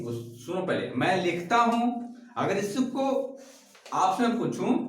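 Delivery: ordinary delivery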